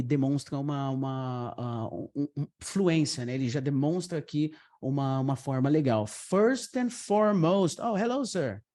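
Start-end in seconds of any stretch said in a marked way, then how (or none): 6.1-7.8 FIRST lifted and stretched; first and foremost, drawn out. Shown as a demonstration. Teacher modelling an interview opener, slow and lifted. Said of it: "se você lançar um first and foremost".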